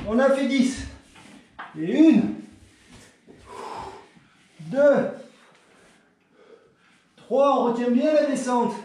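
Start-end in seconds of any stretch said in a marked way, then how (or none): 1.8-8.7 counting reps mid push up; doing push ups. Real, not acted. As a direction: count out while straining through reps. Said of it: "ce ne sont pas des vraies pompes".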